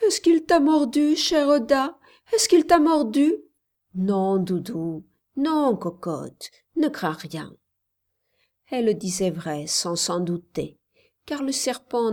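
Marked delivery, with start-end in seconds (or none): 0.1-3.3 high, loud, anxious questions rising at the end; tearful child. A narrator's reading. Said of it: "en la serrant et en pleurant". 3.9-7.6 put-on slow, soothing murmur to a child; tender reassurance. A narrator's reading. Nurse reassuring the crying child.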